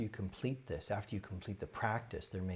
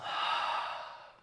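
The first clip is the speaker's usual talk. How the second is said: audible sigh on the out-breath; voiceless, releasing breath out; demonstrated exhale. Shown as a demonstration. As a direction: let go with an airy sigh. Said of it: "Let go on the exhale".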